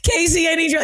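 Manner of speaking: high, hammed-up pleading; mock desperate plea. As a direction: beg a friend in mock desperation. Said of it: "And I'm like".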